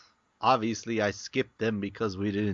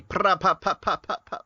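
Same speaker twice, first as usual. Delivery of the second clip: mock gunfire; 7 semitones higher, much faster than usual. Imitating gunfire.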